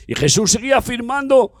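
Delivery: normal talking voice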